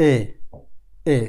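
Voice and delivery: এ said as the open German ä; ä demo; German ä sound. Shown as a demonstration. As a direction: let এ open into the umlaut ä. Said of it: "এর উপরে umlaut থাকলে".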